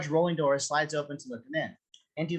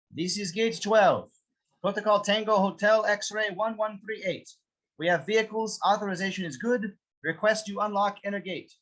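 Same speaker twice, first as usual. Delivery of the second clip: radio report; 6 semitones higher than usual. Acted. Guard reports over the handset.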